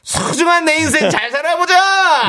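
high declaimed slogan; high-pitched toast recital; acrostic toast. Shown as a demonstration. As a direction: build to a high, stretched-out rallying line.

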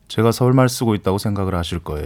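smooth it out like a gentle Seoul speaker; 부드럽게. Shown as a demonstration. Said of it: "좀 더 부드럽게".

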